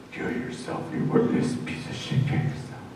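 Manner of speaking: harsh unvoiced whisper, menacing; demonic voice. Man reenacting the voice turning demonic, menacing whisper. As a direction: hiss it like a demon in his head. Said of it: "And then it gets demonic".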